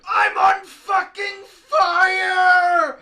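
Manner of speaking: slow, high, crying scream; anguished scream. Acted. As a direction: wail it as you burn. Voicing burning character.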